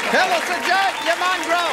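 cheeky shout across the room; playful heckle. Real, not acted. Heckling the lookalike in audience.

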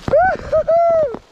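high drawn-out whooping cheer; gleeful whoop. Real, not acted. Whooping in hail storm.

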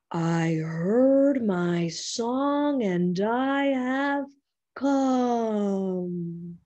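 very slow, loud, drawn-out whale voice. Acted. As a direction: boom each word out slowly, like a giant whale.

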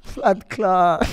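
high, loud shocked "what"; incredulous. Real, not acted. Man asked about taking dates to restaurants, incredulous. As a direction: recoil at the very idea.

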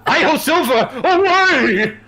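high, loud Lone Ranger cry; cowboy rallying cry. Pretend. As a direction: yell it like a cowboy hero. Cowboy catchphrase.

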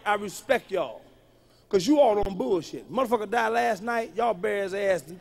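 ordinary talk

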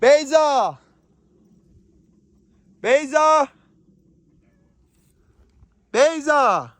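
high, carrying call of the name. Real, not acted. Father calling his little daughter to answer. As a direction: call her name across the field.

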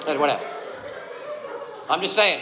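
normal talking voice

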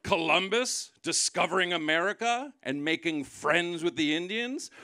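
ordinary delivery

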